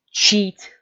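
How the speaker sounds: sudden high, loud yell; high-pitched outburst; shouted accusation. Pretend. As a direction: shout it out as a sharp call-out. Calls out a lifter's cheating.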